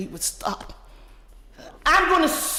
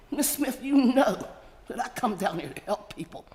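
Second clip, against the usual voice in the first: usual speaking voice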